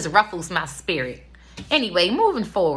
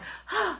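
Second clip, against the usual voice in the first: mock exhausted gasp; 13 semitones higher, 8 dB quieter than usual. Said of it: "Robin like".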